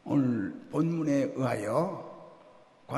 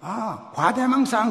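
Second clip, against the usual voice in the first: proud wrong answer; 7 semitones higher, 7 dB louder, slower than usual. Retold or imitated. Son's triumphant wrong answer.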